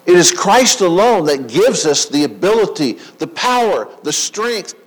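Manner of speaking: loud building preacher's call; preaching at full force. Acted. Preaching climax.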